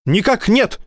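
high, crisp report; military report. A narrator's reading. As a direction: snap to attention and report. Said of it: "отрапортовал он".

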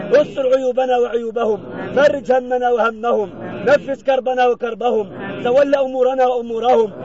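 chanted prayer with held syllables; intoned supplication. Acted. Imam leading mourners in pleas for the deceased, intoned supplication. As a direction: plead insistently in measured, level-toned phrases.

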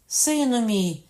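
high, loud, drawn-out call; crying out. A narrator's reading. Katherine reaches from window to son, crying out. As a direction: call out to a long-awaited son. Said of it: "крикнула Катерина".

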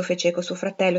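plain reading voice

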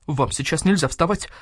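ordinary delivery, nothing marked

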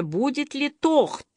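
"тохт" with a throaty kh in place of the R; burred R. A narrator's reading. Quoting three-year-old Masha asking for cake, burred R in "тохт". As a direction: say "тохт" like a small child who can't roll the R. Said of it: "Картаве".

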